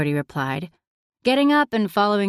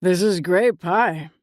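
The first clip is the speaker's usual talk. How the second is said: slow, talking through a mouthful of pie; mouth full. A narrator's reading. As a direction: say it round a bite of pie. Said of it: "he said, mouth full".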